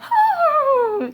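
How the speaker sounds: a sudden squeaky laugh; high burst of laughter. Real, not acted. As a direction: let out a high shriek of laughter. Bursts out laughing at own joke.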